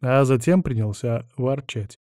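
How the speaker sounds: plain reading voice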